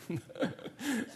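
short quiet mocking chuckle; a little laugh at his old money; soft amused laugh. Hotel clerk laughing at his lira, soft chuckle. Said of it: "She just starts laughing".

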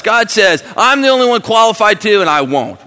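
loud, high pulpit cry; full-force preaching. Acted. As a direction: hammer it home to the congregation.

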